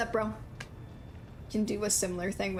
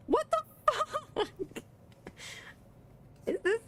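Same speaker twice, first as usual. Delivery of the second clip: stunned disbelief. Real, not acted. Streamer reacting to the romance option, shocked. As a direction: squeak it out in shock.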